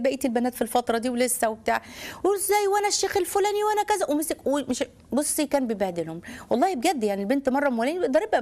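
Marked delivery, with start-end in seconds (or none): 2.2-4.8 a pitch-high mock of a self-important father; lightly mocking a self-righteous dad; mock pompous father. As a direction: parody his proud boasting. Mocking the pious strict father.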